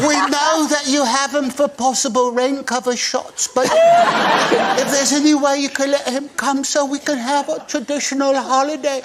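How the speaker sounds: high-pitched imitation of pleading children; adorable kids. Children asking the director to free their dad, imitated high plea. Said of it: "his kids sent the most adorable video".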